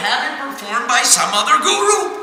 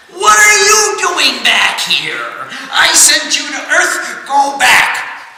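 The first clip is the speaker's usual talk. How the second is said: higher, louder, slow scolding; angry rebuke; 5 semitones higher, 6 dB louder, slower than usual. Acted. Indra scolds Satyabrat at the gate, angry shout. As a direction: turn him away hard. Indra angrily sends him back.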